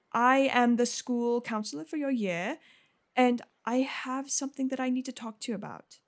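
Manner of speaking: effusive counselor, rushing then checking herself; chatty, welcoming visitor from a school; friendly school counselor. Acted. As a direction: greet the child with lilting, eager politeness. Game master voices Miss Patil.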